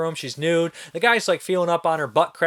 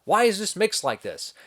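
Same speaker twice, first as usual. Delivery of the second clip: higher, slow, incredulous questions; exasperated bafflement; 7 semitones higher, slower than usual. Retold or imitated. Viewer questioning the bizarre sound mix, baffled and high-pitched. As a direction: act out bewildered disbelief, pitched up.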